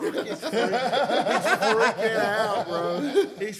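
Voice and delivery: can barely tell it for laughing. Real, not acted. Retelling friend's reaction.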